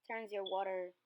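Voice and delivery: usual speaking voice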